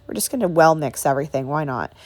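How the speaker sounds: normal talking voice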